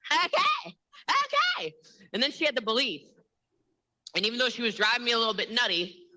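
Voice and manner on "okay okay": very high-pitched, exaggerated reply; mock squeaky okay. Retelling a talk about ten days, squeaky mock agreement. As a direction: squeak it out in a put-on voice. Acts reaction to the ten-day trial.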